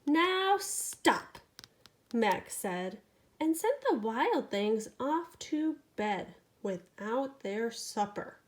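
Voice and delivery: lively bedtime storytelling; animated picture-book reading; reading to a child. A narrator's reading. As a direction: read it to a sleepy toddler. Reads bedtime story aloud.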